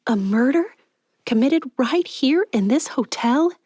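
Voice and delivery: high, astonished disbelief; feigned shock. Acted. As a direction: play it shocked for the attendant.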